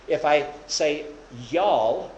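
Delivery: drawled Southern "y'all"; Southern accent, lightly put on. Shown as a demonstration. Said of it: "in the Southern dialect".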